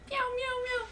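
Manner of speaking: high, soft meowing; soft cat meow. Shown as a demonstration. Comic repeats her catchphrase, soft high meows. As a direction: meow softly like a kitten. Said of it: "repeats her catchphrase".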